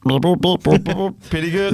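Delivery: slow, drawn-out alien voice; slow otherworldly alien voice. Pretend. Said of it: "Do your best alien voice".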